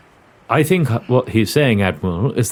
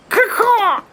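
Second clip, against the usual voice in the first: high shrill bird caw; parrot squawk. Acted.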